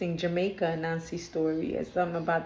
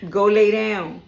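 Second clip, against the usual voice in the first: much louder order to a pet; loud command; 9 dB louder, slower than usual. Real, not acted. Reader ordering her pet away mid-reading, loud command. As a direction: send the dog off, full voice.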